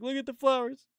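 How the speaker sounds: mock sing-song soothing. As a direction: croon it like calming a child. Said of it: "she's like".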